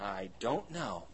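soft, low, slow mock puzzlement; hushed, drawn-out act of not knowing; bemused shrug. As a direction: drag it out quietly, as if clueless. Puzzled answer to curious people.

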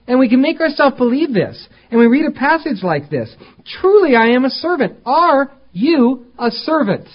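high, loud pulpit challenge; impassioned preaching. Real, not acted. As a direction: raise the voice high and press the congregation. Preaching at full force.

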